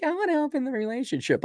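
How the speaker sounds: high mocking wife voice; high, simpering imitation of a wife; mocking wifey. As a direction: squeak it out like a wife asking sweetly. Voicing wife asking to open.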